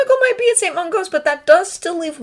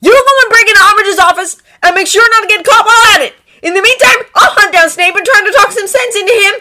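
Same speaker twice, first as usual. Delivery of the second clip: loud, high, forceful planning; raised-voice urgent instructions; exasperated; 14 semitones higher, 12 dB louder than usual. A narrator's reading. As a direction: lay out the plan at full volume. Character loudly lays out plan.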